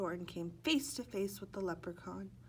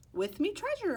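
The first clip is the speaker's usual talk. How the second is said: boastful leprechaun; 12 semitones higher, 7 dB louder than usual. Acted.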